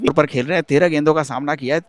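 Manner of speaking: normal talking voice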